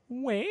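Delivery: put-on high baby "wah"; mock her whining with a baby cry; husband teasing his wife with a baby wail. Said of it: "look over and go".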